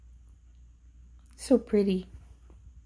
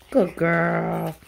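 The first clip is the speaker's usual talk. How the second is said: ordinary talk, nothing marked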